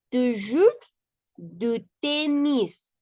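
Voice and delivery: slow, careful French "Tu joues du tenis"; French read aloud. Shown as a demonstration. Teacher reads the French example to the class.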